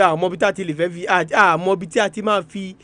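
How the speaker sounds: ordinary talk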